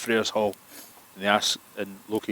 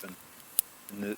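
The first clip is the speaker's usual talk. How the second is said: plain reading voice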